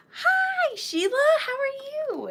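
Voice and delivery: high, squealing hello; excited greeting. Real, not acted. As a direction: greet her with delight. Excited greeting to a viewer arriving.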